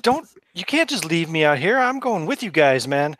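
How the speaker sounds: put-on high, pleading young boy; pleading kid voice. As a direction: beg not to be left behind.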